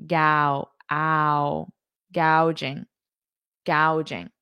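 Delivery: "Gao, ow, ow, ow" drawn out, then "gouging" said whole; pronunciation drill. Shown as a demonstration. Teacher drilling viewers on saying "gouging", slow and clear. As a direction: break "gouging" into its "ow" sound, then join it back. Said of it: "notice the pronunciation".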